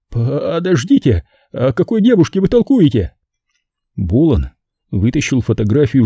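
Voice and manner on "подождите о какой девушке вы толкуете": high, frantic begging pimp voice; a terrified bar owner offering all his money; panicked pimp pleading. Acted. Terrified Simon begging the killer to take the money, high and frantic.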